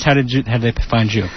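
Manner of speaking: usual speaking voice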